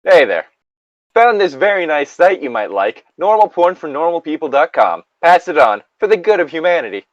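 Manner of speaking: high, loud chirpy service voice; customer service voice. Acted. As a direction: read it like an overly friendly help desk. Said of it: "customer service voice".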